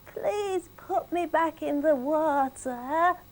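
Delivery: slow, high fish voice; a little magic fish begging; pleading fish. Acted. Talking fish begging fisherwoman Millie to be freed.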